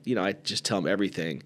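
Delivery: normal talking voice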